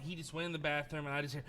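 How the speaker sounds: ordinary talk, nothing marked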